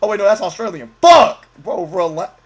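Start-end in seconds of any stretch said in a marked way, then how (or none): 1.0-1.5 a sudden, sharp, loud curse; startled yell; real shout. Real, not acted. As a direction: blurt it out loud in surprise. Sudden outburst at the screen.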